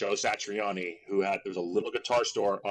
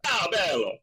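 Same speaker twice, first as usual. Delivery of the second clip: Italian accent; 8 semitones higher than usual. Shown as a demonstration. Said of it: "I just do my Gianni Versace".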